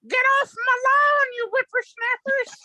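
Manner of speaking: put-on high, loud cranky geezer shouting at kids; a mock old-timer shouting at whippersnappers; grumpy old man yell. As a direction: holler like an old man shooing kids off the lawn. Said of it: "as a little kid going".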